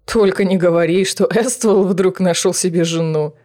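smirking. Acted. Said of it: "с усмешкой сказал".

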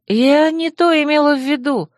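ordinary delivery, nothing marked

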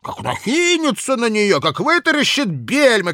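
put-on loud, high drunken bluster; drunken blustering official. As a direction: boast it loud like a tipsy clerk. Drunk Kozulin's long boast.

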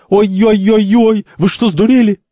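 wailing yell. Acted. Santori attacked by all three, yelling out. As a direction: howl in protest. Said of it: "Сантори вопит".

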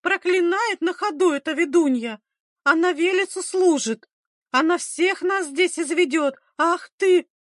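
high, agitated shrill denunciation; wailing. A narrator's reading. Aunt accusing the midwife before villagers, wailing. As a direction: denounce her in a high wail. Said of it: "заголосила та в ответ".

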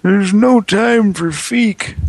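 squeaky high-pitched cartoon character.

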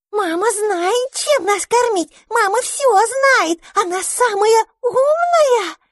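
put-on very high, slow squeaky baby voices; the little ones squeaking that mama knows best. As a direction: pipe it up proudly like tiny hatchlings. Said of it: "пищали тем временем подопечные".

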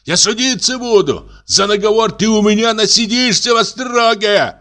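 excited, outraged high-voiced tirade; increasingly agitated shouting. A narrator's reading. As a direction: rant accusations in a screechy high voice. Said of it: "всё более возбуждаясь".

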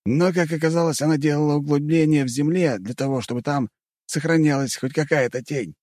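lightly voiced storyteller hero; character voice. A narrator's reading. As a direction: tell the fight story in character. (персонаж рассказывает о бое)

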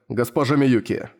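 ordinary delivery

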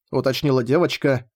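usual speaking voice

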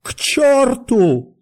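high, slow anguished yell; tearful cry. A narrator's reading. Doctor running after guests, tearful cry. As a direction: stretch the curse into a weeping shout. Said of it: "крикнул он плачущим голосом".

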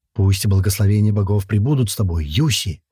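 plain reading voice

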